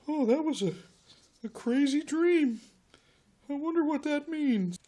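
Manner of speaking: high, musing character voice; lightly acted high-voiced bewilderment; puzzled waking Jacob. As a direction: wake up and wonder aloud, pitched high. Jacob waking from his dream.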